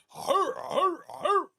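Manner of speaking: high hammed-up crowd grunts; milking the applause. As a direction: soak up the cheers with hur hur. After hyping the guest.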